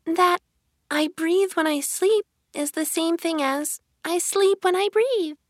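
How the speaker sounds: very high, monotone little dormouse voice; sleep-talking Dormouse. A narrator's reading. Dormouse talking in its sleep, tiny high voice. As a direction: drone it high, as if asleep. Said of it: "talking in its sleep".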